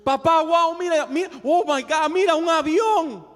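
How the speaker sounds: high, loud wonder, tumbling out to dad; excited boy. Acted. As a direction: act an amazed youngster pointing things out. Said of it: "Le decía el papá". (muchacho emocionado, voz aguda y fuerte)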